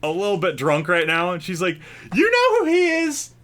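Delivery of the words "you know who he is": excited high shriek. Acted. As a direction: squeal it out, thrilled to recognise him. Drunk woman asks bar.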